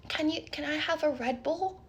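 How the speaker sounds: timid mock-child request; imitated child asking. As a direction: ask sweetly like a small boy. Calmed child asks for a drink.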